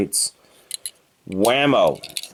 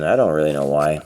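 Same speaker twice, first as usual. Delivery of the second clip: normal talking voice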